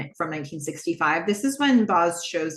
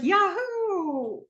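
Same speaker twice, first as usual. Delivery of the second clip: attempted cheer; 11 semitones higher than usual. [name] checking whether it's enough for Donna, whooping. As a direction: give the cheer a real try. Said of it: "Is this enough?".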